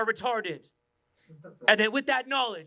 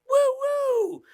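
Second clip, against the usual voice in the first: very high, loud whoop; high playful woo; 5 dB louder than usual. Acted. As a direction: whoop it like a goofy reveller. Acting the wanky man.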